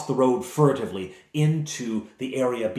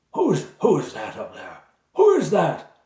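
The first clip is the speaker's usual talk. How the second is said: higher, put-on prisoner voice, louder on the repeat; startled captive Sir Trevor; 7 semitones higher, 5 dB louder than usual. Captive knight calling up to unseen visitors, startled. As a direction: call up from a cellar, demanding who's there.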